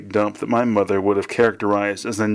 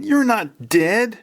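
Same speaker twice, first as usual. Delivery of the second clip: high, drawn-out cry; slow, high-pitched protest of disbelief; shocked disbelief; 12 semitones higher, much slower than usual. A narrator's reading. Son to his father's ghost, shocked high cry.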